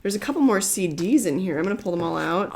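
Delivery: ordinary delivery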